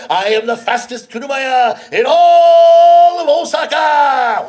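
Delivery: slow, loud proud boast; boastful shout. Acted. Rickshaw man boasting to his customer, slow loud shout. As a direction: puff up and shout it out.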